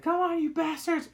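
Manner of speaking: put-on a girl firing at a hunting party; defiant yell. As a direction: taunt them while shooting. Ellie shooting at hunters.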